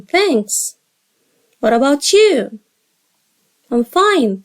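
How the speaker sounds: ordinary delivery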